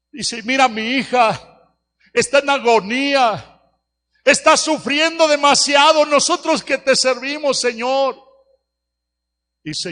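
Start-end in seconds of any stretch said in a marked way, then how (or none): 0.4-8.2 loud, high anguished plea to God, half-crying; desperate pleading prayer, much louder and higher; retold tearful prayer. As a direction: cry out to the Lord in grief. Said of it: "le dije al Señor llorando".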